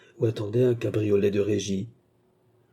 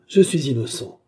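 louder, higher cry of innocence; louder and higher exclamation; exclaiming; 5 semitones higher, 6 dB louder than usual. A narrator's reading. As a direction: declare it out loud, insistent. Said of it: "s'écria le jeune homme".

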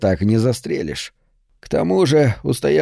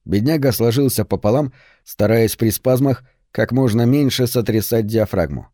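ordinary delivery

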